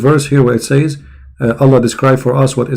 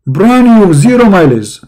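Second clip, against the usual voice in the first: salesman voice; 5 semitones higher than usual. Acted. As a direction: sell it like a used car dealer. Sarcastic salesman pitch.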